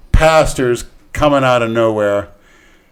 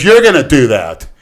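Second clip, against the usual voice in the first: loud imitated cop giving orders; bossy cop order; 4 semitones higher, 10 dB louder than usual. Cop telling the driver to do it himself, loud and bossy. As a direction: put the job firmly back on him, raised voice.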